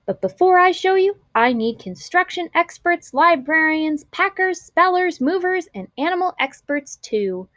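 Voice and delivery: lively teacher voice. A narrator's reading. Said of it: "Missus McBee's eyes twinkled".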